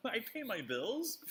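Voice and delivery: high-pitched, drawn-out comic self-defense; playful indignation. Real, not acted. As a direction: protest it high and slow, mock-offended. Defends self while being laughed at.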